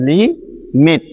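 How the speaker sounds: Limit said slowly as li, pause, mit; teacher's correct split of limit, li then mit; broken into syllables. Shown as a demonstration. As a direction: stretch limit into two clear beats, li and mit. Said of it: "সেজন্য আমাদেরকে বলতে হচ্ছে".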